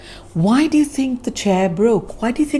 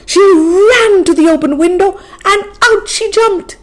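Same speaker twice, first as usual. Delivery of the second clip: higher and louder, urgent; excited escape; 11 semitones higher, 7 dB louder than usual. A narrator's reading. Narrator telling the escape higher and louder. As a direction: rush it like a chase.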